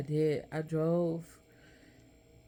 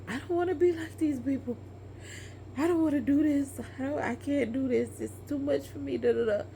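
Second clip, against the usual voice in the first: retold plea to supervisor, high and strained; retold breaking down; 8 semitones higher than usual. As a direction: voice climbing in panic as she retells it. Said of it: "And I broke down".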